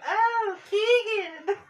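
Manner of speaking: high squeaky exclamation; high mock voice. Reading a funny card message.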